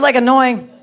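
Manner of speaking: ordinary talk, nothing marked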